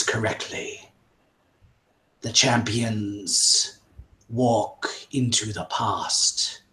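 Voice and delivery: slow, hushed prophetic voice; mystic witch doctor. Acted. Witch doctor revealing the prophecy to the champions, hushed and slow. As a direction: read the signs like a seer.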